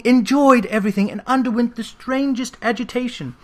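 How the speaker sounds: usual speaking voice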